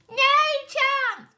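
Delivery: high, loud refusal from the pigs; pigs chorusing. Acted. The three pigs refuse the wolf, high and loud. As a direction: fling a high squeaky no. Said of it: "chorused the pigs".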